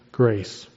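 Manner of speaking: "grace" set apart, low and falling; emphasis on grace. Real, not acted. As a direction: land "grace" low after a short pause. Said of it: "I emphasize the word".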